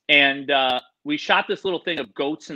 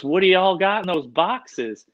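slow Southern Indiana drawl, a bit higher; Southern Indiana accent; 5 semitones higher, 4 dB louder, slower than usual. Retold or imitated. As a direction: ask it like the nosy Indiana hotel kid. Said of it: "if you don't talk like that".

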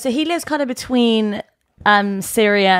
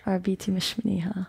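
whispered; 3 semitones lower, 9 dB quieter, faster than usual. Real, not acted. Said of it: "Also, you whispered that".

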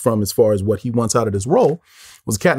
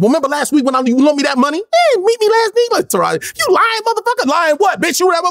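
high-pitched improvised accusatory rant; acting out a heated outburst; going off. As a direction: vamp angrily, voice pitched way up. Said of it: "I was just going off".

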